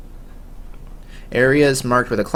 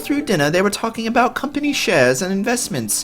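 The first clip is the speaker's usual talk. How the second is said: put-on higher, girlish noble lady; worried young lady asking about her father, higher voice; 9 semitones higher than usual. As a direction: sound like a sheltered young aristocrat.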